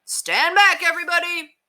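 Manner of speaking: high, loud shout to clear the way; yelled warning. A narrator's reading. Neighbor with the hose yelling at the crowd, loud and high. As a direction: call out to stop the dogfight. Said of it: "he yelled".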